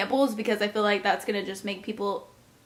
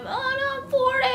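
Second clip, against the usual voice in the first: mock wail. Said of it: "I'm like".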